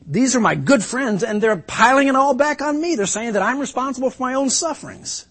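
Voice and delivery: high, loud disbelieving cry; can't believe his friends turned on him; astounded hurt. Acted. Job stunned by his friends' accusations, disbelieving.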